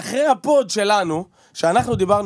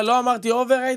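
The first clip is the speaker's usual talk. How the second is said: higher-pitched mimicking of a co-host; mock quote; 7 semitones higher, slower than usual. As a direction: teasingly quote him back, pitched up. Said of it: "אתה כל הזמן אומר". (חיקוי מתגרה בטון גבוה)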